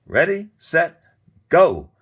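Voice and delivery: high, loud countdown shout; bird's yell. A narrator's reading. Bird yelling the start signal to the others. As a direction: yell it to launch the tug. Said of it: "One of the birds yelled".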